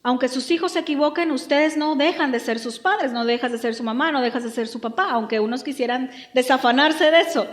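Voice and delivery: usual speaking voice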